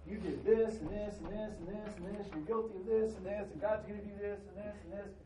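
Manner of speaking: lightly voiced condemning preacher; mock preacher. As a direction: rattle off the charges like a scolding preacher. Mock preacher listing sins.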